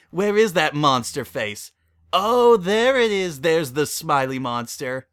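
loud, slow teasing singsong; playful monster hunt. Acted. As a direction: drag it out to tease the kid. Tickling, hunting the monster.

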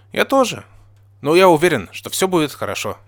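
high-starting reassuring exclamation; exclaiming. A narrator's reading. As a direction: exclaim with hopeful conviction. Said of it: "воскликнул я".

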